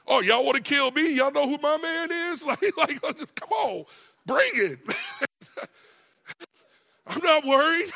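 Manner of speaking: high, loud, laughing taunt; wife taunting attackers. Retold or imitated. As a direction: brag about her dangerous husband with a laugh.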